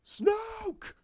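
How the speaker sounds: very high, strained yell; screamed name. Retold or imitated. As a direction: cry out the name in a drawn-out scream. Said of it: "then he goes".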